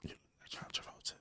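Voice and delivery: fast teasing whisper. Real, not acted. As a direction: whisper the jab quickly. Whispered tease.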